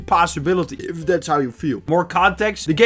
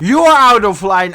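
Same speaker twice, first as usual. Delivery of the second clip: loud outburst leaning hard on "You are"; indignant retort; 3 semitones higher, 8 dB louder than usual. Acted. Partner throwing the accusation back in a text fight, loud indignant retort. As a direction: fire it back loudly, stretching "You are". Acting the woman's angry retort.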